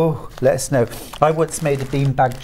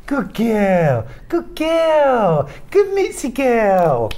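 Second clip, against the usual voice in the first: high, slow doggy praise; praising the dog; 8 semitones higher, much slower than usual. Shown as a demonstration. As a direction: coo praise at a good dog. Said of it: "You ready for this?".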